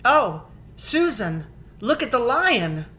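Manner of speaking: put-on a little girl gasping in awe; excited girl. Lucy showing Susan the lion, awed. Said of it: "Lucy said".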